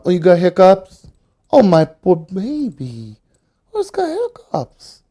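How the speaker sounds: high, slow pity voice. Real, not acted. Owner coos at his hiccuping dog, high and slow. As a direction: coo over the hiccuping pup.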